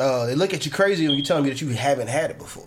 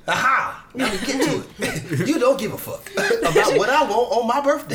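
real laughter breaking into the words; gleeful laughing gotcha; laughing accusation; 6 semitones higher than usual. Real, not acted. Laughing while accusing her friend.

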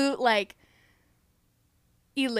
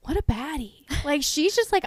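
usual speaking voice

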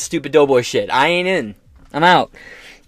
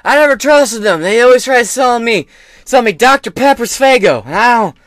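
put-on much higher and louder, confused old man; mock rambling Biden; 7 semitones higher, 7 dB louder than usual. Biden answering the governor as a confused rambling old man. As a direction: ham up a doddering president.

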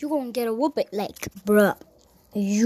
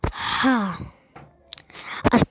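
usual speaking voice

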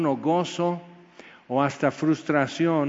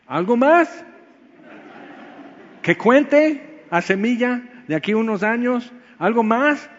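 high and loud, begging for more; a loud, rising, eager question; eager plea; 7 semitones higher, 5 dB louder than usual. Acted. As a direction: call out hungry for another word. Pleading God for more words.

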